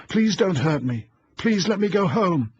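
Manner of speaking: put-on high, loud crying plea of a small child; sobbing child's plea. A narrator's reading. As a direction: beg through sobs like a frightened child.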